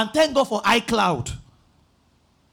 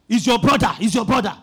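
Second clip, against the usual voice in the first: loud pushy claim; 7 dB louder, faster than usual. Retold or imitated. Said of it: "Why are you talking like that?".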